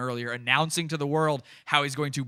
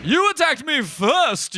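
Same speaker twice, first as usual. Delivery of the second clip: mock British accent; 9 semitones higher, 6 dB louder, slower than usual. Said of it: "he's doing the British voice".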